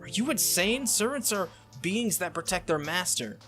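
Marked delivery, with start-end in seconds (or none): 0.0-0.8 higher-pitched, affronted question; acted indignant disbelief; incredulous. Saber's offended reply in quarrel.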